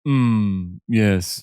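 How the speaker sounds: low, pompous agreeing hum; a hint of a snooty intellectual; posh voice. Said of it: "the horse has become Frasier".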